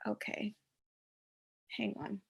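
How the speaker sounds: whispering to herself; whispered aside. Real, not acted. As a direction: whisper it under your breath.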